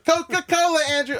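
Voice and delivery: very high, loud exclamation; excited mock shout. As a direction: squeal it out as an imitated cheer. Acts out excited answer to Andrew.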